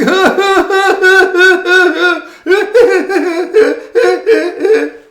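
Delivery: high, loud gleeful cackle; leprechaun cackle. Acted. The leprechaun laughing at fooled Seamus, loud high cackle.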